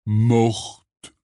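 heavy, grunted single word; growled. A narrator's reading. Hagrid tells of his mother, growling. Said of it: "grogna Hagrid".

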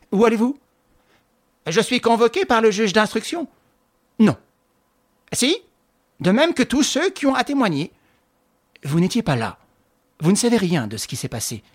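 a quarrel of short retorts; woman confronting her rival; tense exchange. A narrator's reading. Tense exchange between two women.

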